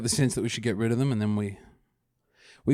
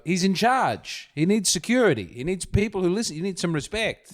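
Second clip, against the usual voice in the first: mock admiring; 7 semitones higher than usual. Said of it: "you go".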